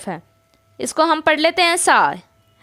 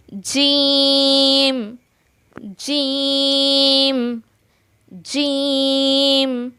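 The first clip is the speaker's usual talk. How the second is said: जीम stretched very slow; drawn-out letter; much slower than usual. Shown as a demonstration. Teacher stretching jeem very slowly for students. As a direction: hold जीम long and steady. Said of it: "ये है".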